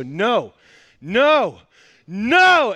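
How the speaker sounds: mock tantrum, building to a high yell; imitated stubborn kid working up to a shout; escalating toddler refusal.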